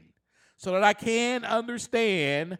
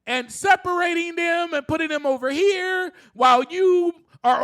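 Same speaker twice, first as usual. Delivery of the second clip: raised, loud preacher's push; preaching; 6 semitones higher, 5 dB louder than usual. Acted. Urging his listeners like a preacher, loud and high. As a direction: drive it home like a sermon.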